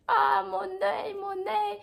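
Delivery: high, wailing cry over the eye; crying out in pain, partly acted; retold crying. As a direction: whimper it like a panicked kid. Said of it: "je pleurais sur place".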